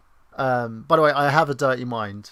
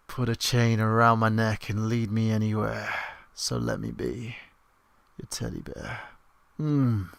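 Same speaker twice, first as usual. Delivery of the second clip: [name] voice. Shown as a demonstration. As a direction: croon it like [name]. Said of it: "I can do an [name] limerick".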